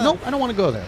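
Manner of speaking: light hammed-up refusal; reluctant nope. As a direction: back away from the scary area. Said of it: "I was like".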